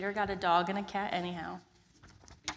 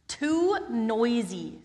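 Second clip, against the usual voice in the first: complaining; 3 semitones higher, 5 dB louder, much slower than usual. Acted. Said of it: "said Peter".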